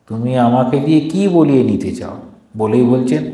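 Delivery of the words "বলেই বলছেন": ordinary delivery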